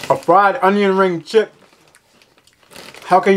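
crunchy snack in mouth; talking while chewing. Real, not acted. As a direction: mouth partly full of chips. Seen 3: Bites Funyun at -7; jaw still working through first seconds.

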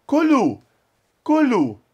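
loud, high imperative with falling end; calling to children. Acted. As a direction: urge the kids to eat. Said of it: "Olhando pras crianças".

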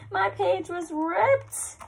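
laughing. Real, not acted. Discovers missing page, laughs.

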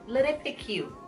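normal talking voice

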